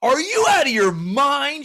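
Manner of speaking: high, drawn-out disbelief; retold high-pitched indignation; imitated incredulous wife. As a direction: wail it in mock outrage. Acting out his outraged wife.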